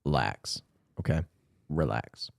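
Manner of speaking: low, hushed, softly spoken; soothing. Real, not acted. Host talking to the cat, low and soothing. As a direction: settle the cat with quiet words.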